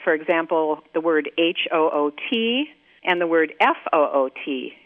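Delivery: ordinary delivery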